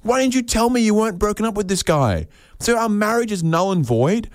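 high, outraged husband's voice; shocked husband. Acted. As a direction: shoot way up in pitch, outraged. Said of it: "the husband's there going".

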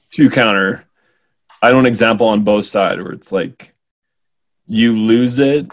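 ordinary talk, nothing marked

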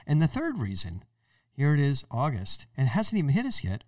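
usual speaking voice

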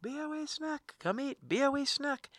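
high, drawn-out mealtime holler; yelling up the stairs. Acted. Parent in a multi-story house, yelling up the stairs to eat. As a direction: call the kids down from another floor, very high. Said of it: "So I'll yell up the stairs".

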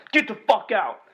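high, loud angry yell; retold shout. Manager throwing the clerk out, furious shout. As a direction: bark him out the door. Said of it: "he's like".

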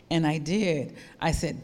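usual speaking voice

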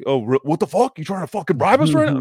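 fast, high, loud outraged yell; imitating loud Luke. Luke yelling at the cop who wants a bribe, fast and high. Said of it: "his voice is really loud".

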